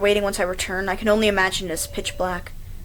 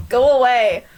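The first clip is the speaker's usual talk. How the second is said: louder firm go away; loud pushing away; 7 dB louder, slower than usual. Acted.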